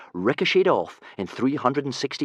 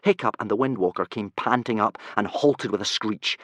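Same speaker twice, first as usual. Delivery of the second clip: ordinary delivery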